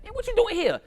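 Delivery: high, startled retort to her husband; sharp surprised "man, what you doing here"; surprised wife. Retold or imitated. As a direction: shoot the question up, stunned. Wife surprised to see husband captured.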